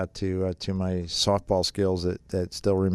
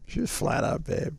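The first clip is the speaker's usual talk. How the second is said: hushed aside; 6 semitones higher, 4 dB quieter than usual. Real, not acted. Gushing aside about heaven's wife.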